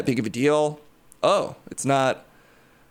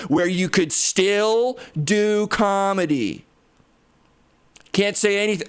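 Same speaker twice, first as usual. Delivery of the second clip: higher, slow, drawn-out Tony Stark; 5 semitones higher, slower than usual. Acted. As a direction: stretch it out like a smug Tony. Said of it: "I did reanimate Tony Stark".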